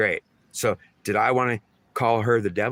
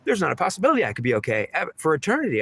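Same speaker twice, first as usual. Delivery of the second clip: high, indignant outburst; acted unforgiving anger; mad goddess; 9 semitones higher, faster than usual. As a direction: voice her fury, pitched well up. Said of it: "she's saying, "I'm so mad".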